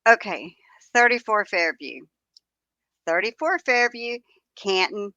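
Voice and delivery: usual speaking voice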